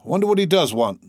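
high-pitched grumbling complaint; aggrieved. A narrator's reading. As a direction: voice a sore grievance. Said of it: "with a grievance in his eye".

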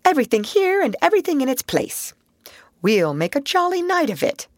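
high, brisk, hearty voice; cheerful bustle. Acted. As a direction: bustle about and cheer him up. Rat bustling, cheering up Mole.

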